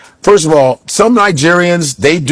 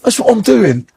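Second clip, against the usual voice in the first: higher-pitched mock British accent; 4 semitones higher than usual. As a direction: imitate a Briton with a put-on British accent. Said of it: "when you have a British accent".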